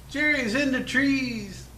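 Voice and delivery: high, slow, drawn-out call; mock battlefield call. World War Two soldier warning comrades, high mock call. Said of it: "as they said back in World War Two".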